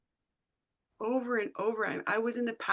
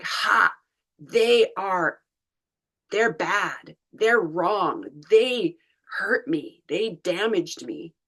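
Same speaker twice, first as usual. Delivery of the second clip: louder, accusing inner gremlin; 6 dB louder, slower than usual. Retold or imitated. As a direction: spit it out like a wounded, blaming gremlin. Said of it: "their gremlin gets turned on, is like".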